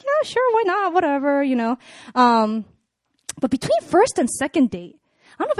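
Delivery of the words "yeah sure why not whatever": offhand agreement. Retold or imitated. Woman recounts agreeing to a second date, offhand. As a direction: shrug it off casually, starting high. Voices own casual reply to date.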